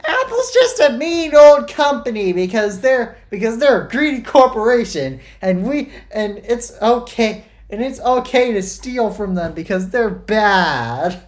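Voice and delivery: loud, high-pitched mocking tirade; sarcastic parody rant. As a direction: parody a whiny bully ranting, way up high and loud. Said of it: "Totally not parodying it".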